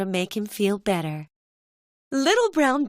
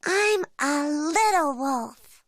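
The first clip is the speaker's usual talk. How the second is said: slow, higher cub voice playing wolf; little bear voice; 5 semitones higher than usual. Little Brown Bear joining dad's wolf game, slow higher cub voice. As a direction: announce it like a proud little wolf. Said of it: "Little Brown Bear says".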